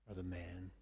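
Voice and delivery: a prophet's quiet second verdict; hushed verdict repeated. Acted. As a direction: let it sink in, almost under the breath. Repeated accusation.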